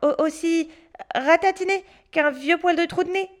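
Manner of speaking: mock the witch cheekily; boy taunting the tiny witch; cheeky boy.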